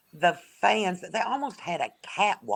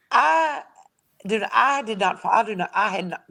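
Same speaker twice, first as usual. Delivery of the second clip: tripping over the start, higher pitch; flustered stumbling; 4 semitones higher than usual. Real, not acted. Guest stumbling to explain herself, flustered false starts. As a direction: stammer and restart the sentence. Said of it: "I'm stumbling a little bit here".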